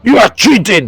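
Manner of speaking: high-pitched acted outburst; upset accusation. GM voices the beaten Cyclops accusing Lilith, upset and high-pitched. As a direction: accuse like an upset sore loser. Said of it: "stands off the chair all upset".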